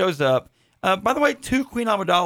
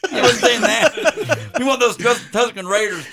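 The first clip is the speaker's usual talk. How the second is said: laughing high and loud; 7 semitones higher, 4 dB louder than usual. Real, not acted. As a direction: laugh out the words in protest. Laughing while defending himself.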